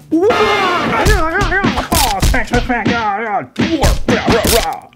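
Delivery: high, loud cartoon fight noises; high-pitched hammed-up battle sound effects; mock fight cries. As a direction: yelp and yell out a pretend toy brawl. Acting out the figures' fight.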